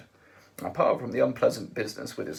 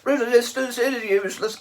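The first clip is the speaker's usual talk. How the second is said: high, loud bellowed slogan; Vogon guard shout; 13 semitones higher, 9 dB louder than usual. A narrator's reading. Vogon guard shouts at his prisoners, loud bellow. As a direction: drone the slogan like a brute.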